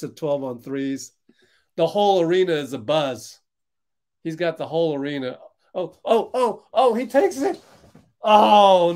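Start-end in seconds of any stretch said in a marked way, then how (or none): 5.7-8.9 climbing higher and louder with each oh; real rising hype as he shoots; rising excitement. Real, not acted. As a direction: build the suspense into a yelp. Building tension on a shot.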